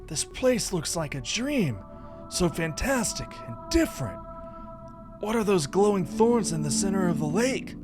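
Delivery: high, wonderstruck game character; awed companion character. Acted. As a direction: play the companion marveling, pitched high.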